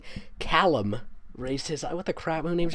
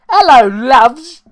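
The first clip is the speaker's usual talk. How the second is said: much higher, louder, slow, failed celebrity chef voice; Gordon Ramsay try; 11 semitones higher, 19 dB louder, much slower than usual. Acted. Reader voicing Harry as Gordon Ramsay, hammed. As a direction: stretch out hello like a TV chef. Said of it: "he's gonna be Gordon Ramsay".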